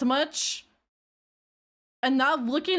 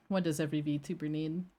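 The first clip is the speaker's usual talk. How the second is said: softer repeat; 6 semitones lower, 6 dB quieter than usual. Shown as a demonstration. Streamer quietly repeating her old line, softer and lower. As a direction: lower and softer, asking again.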